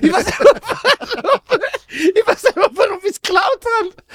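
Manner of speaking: a laughing mock confession; imitating friend. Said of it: "das sagt er".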